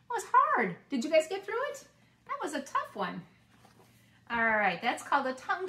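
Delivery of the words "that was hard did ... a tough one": much higher and louder, breathless relief; relieved exclaim. Real, not acted. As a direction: blurt out relief after the rush.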